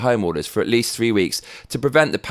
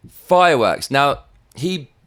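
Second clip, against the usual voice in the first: ordinary talk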